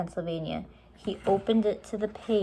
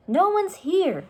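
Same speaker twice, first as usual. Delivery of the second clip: high, loud shouted reply; girl shouting down from a treehouse; shouting back; 12 semitones higher, 6 dB louder than usual. A narrator's reading. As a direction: call back down from above. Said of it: "she shouted back".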